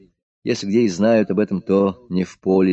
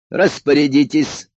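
higher, louder, slow command; barked order; 6 semitones higher, 5 dB louder, slower than usual. A narrator's reading. Emperor orders the militiamen, barking. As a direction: bark it at the guards. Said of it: "рявкнул император".

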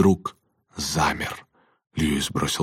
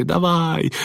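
high, drawn-out urgent call; pleading boyish urging; 11 semitones higher than usual. Acted. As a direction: spur your friend on in a high boy's voice. Boy urges friend to escape.

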